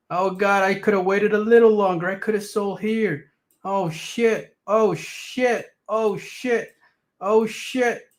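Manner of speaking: high, hammed-up despair, building; panicking seller. Mocking the early sellers, high hammed-up panic. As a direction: wail like a trader who sold too early.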